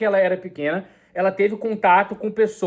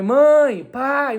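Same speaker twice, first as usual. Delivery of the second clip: imitating a child crying out for mum, much higher and louder; child calling out; 6 semitones higher, 4 dB louder than usual. Injured child calls for his mother and father, mock call. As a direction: call out like a hurt kid.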